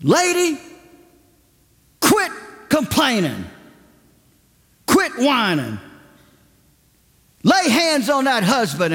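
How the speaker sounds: high, forceful scolding; preacher scolding a complaining woman, high and forceful; stern preacher rebuke. Retold or imitated. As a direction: scold her like a fiery preacher. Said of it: "He said".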